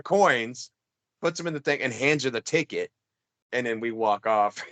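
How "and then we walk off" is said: ordinary talk, nothing marked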